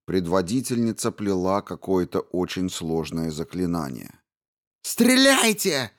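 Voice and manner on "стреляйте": much higher, breaking into a squeal; shrieking order. A narrator's reading. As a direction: scream the command, voice cracking to a shriek. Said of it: "срываясь на визг".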